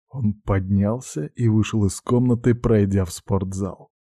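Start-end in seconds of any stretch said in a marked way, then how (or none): none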